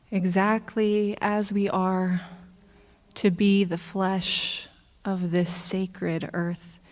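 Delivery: hushed, measured blessing; prayer. Real, not acted. Leads a prayer.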